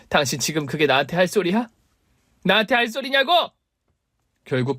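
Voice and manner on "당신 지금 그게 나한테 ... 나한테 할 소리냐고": outraged wife. Acted. Wife's outrage builds.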